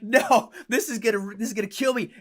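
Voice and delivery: mock despair. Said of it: "And I'd be like".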